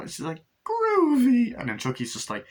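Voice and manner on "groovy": very high, loud, stretched cheer; delighted squeal. Retold or imitated. Delighted reaction to baby girl.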